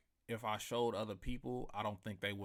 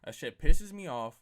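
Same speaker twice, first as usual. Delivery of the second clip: louder and higher, still heated; angry outburst; 5 semitones higher, 14 dB louder than usual. Real, not acted. Host repeating his rant at Chris, an angry shout. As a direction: repeat the complaint with force.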